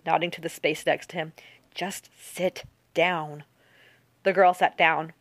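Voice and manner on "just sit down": soft, very slow order; said softly. A narrator's reading. Park nodding the girl to the seat, softly and slowly. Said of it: "Park said softly".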